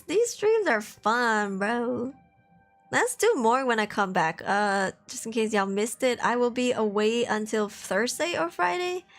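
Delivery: ordinary delivery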